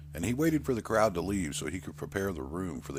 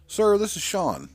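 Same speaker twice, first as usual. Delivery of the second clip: higher, louder and slow, pressing the host; urgent phone call; 13 semitones higher, 8 dB louder than usual. Acted. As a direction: raise the voice to get the manager's attention. Sean phones the host desk.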